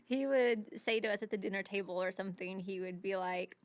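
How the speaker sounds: ordinary talk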